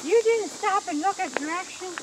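nagging, high and scolding; nag voice. Pretend. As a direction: nag like a wife in the car. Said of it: "Is that your nag voice?".

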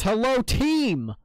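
high, slow, booming hello; loud drawn-out greeting. Real, not acted. Streamer greeting the game team, loud drawn-out call. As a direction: holler it out, stretching the words.

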